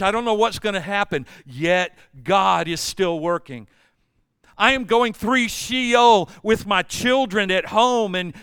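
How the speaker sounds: forceful, rhythmic pulpit refrain; impassioned preaching. Real, not acted. Preacher voices believers going through Sheol, impassioned preaching. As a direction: build the troubles up and hammer the refrain.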